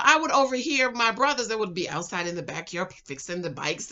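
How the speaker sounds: ordinary delivery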